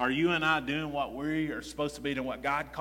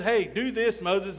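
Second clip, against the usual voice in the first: commanding Lord; 5 semitones higher, 6 dB louder, slower than usual. Retold or imitated. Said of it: "The Lord says".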